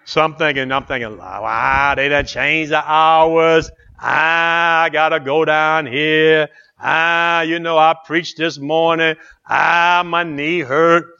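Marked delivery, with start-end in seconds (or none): 1.2-11.1 drawn-out mock moaning; hammed-up self-pitying whine; whining complaint. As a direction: whine and grumble through every long groan. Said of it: "I'm murmuring and complaining".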